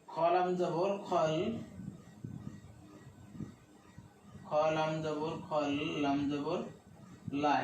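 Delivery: slow spelling, "খল" drawn out, pauses between the pieces; broken into letters. Shown as a demonstration. Teacher spelling slowly for learners, broken into letters. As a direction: spell slowly, stop, then land on "লা" by itself. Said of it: "অক্ষরগুলোকে একটু ভেঙে ভেঙে দেখাই".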